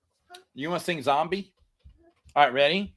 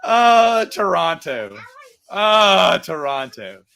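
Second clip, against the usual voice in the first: laugh-cry; 8 dB louder, much slower than usual. Real, not acted. Crying-laugh at Toronto fans.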